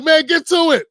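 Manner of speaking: loud, high, impatient outburst; exasperated shout. Retold or imitated. Viewer yelling at a rambling video, exasperated shout. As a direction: yell at the screen to hurry up.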